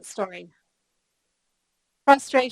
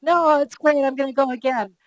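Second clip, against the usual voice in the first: higher, louder, gung-ho; mock enthusiastic; 4 semitones higher, 4 dB louder than usual. Husband and friend brushing off the cold, keen to ski again. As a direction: play the eager skier who wants another lap. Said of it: "My husband and his friend were like".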